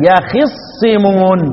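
يخصمون very slowly as ya-khis-simun; kasra on kha. Shown as a demonstration. Teacher showing the remaining readers' way, slow kasra recitation. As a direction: put a clear "i" after خ in يخصمون and double the ص. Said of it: "بكسر الإيه؟ الخاء".

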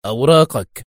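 rough one-word demand; curt, gruff checkpoint order. A narrator's reading. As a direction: demand it brusquely, soldier-like. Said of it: "مغمغما في خشونة".

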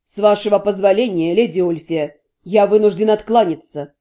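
low, cool formal refusal; cool and polite. A narrator's reading. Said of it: "прохладно проговорил Вашарий".

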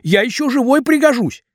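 high, aggrieved pleading; pleading young man. A narrator's reading. Vasya begs.

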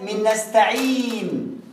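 "نستعين" with the ee drawn out very slowly; long yaa. Shown as a demonstration. Teacher quoting the example, "نستعين" with long held ee. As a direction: stretch the ee in "نستعين". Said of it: "عايز أعرف مخرج الياء".

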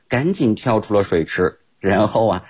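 plain reading voice

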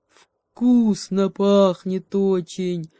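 slow, drawn-out moan; plaintive bear moan. A narrator's reading. Bear moaning about the tasty smell, slow plaintive moan. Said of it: "жалобно простонал медведь".

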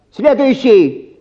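long high call. Acted. Clerk summons the next patient, long high drawn call. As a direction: stretch it out to the room.

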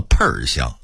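"喷"变调儿化说"喷儿香"; 北京腔说"喷儿香"; Beijing tone change. Shown as a demonstration. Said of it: "变调之后成了". (北京话变调说"喷儿香")

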